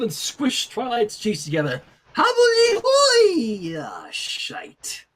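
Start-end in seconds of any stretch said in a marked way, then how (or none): 2.1-3.8 excited. A narrator's reading. Excited line, voice breaks. 3.8-5.0 muttered curse. Real, not acted. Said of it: "let's see what happens".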